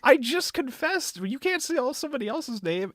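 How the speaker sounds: high-pitched mock complaint; indignant protest. Haruhi scolding him for saying another's name, indignant. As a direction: imitate an offended confessor. Continues acted outraged complaint.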